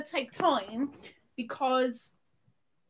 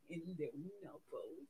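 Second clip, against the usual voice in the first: very low, hushed voice; tiny low coo; 11 semitones lower, 19 dB quieter than usual. Pretend. Streamer cooing over Nubbles, low and hushed. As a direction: drop right down, very soft and low. Cooing the toy's name two ways.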